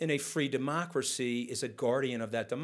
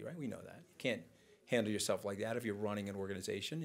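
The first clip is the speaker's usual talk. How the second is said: normal talking voice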